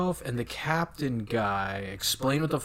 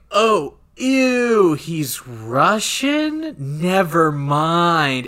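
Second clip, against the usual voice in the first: loud, drawn-out mock disgust; disgusted worm; 6 semitones higher, 8 dB louder, much slower than usual. Ear worm rejecting its Russian host, disgusted. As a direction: act out a squeamish creature backing off, high and slow.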